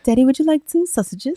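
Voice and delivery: mock sweet voice. Joke voice after sausage pun.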